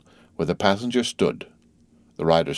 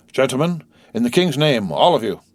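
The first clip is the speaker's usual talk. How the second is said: hurried adjuration; 6 semitones higher, 3 dB louder than usual. Acted. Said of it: "With this hurried adjuration".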